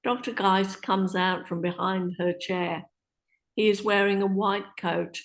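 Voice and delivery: ordinary talk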